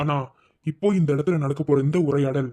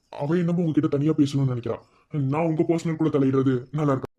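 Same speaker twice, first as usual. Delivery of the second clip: usual speaking voice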